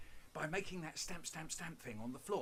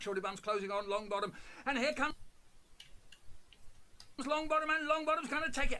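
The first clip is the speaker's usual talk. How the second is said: loud rising race call, faster at the finish; horse racing commentary; 11 semitones higher, 6 dB louder than usual. Retold or imitated. Boy commentating drips as a horse race, loud race call. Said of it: "running commentary".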